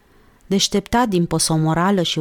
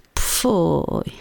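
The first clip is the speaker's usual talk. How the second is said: breathy burst of disdain, no voice; a girl's scornful voiceless pfui; whispered scornful pfui. A narrator's reading. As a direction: blow out a voiceless pfui of contempt. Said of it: "izbucni Olguța".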